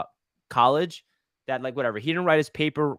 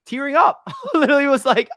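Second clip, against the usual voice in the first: higher and louder, laughing through it; laughing at himself; 11 semitones higher, 7 dB louder than usual. Real, not acted. Fan admits tearing up at a video, laughing. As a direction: crack up at how emotional he got.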